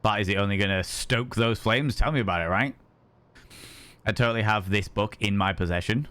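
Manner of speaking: normal talking voice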